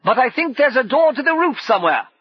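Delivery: high, loud warning shout. Acted. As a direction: call it out over the danger. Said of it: "Brumman shouted".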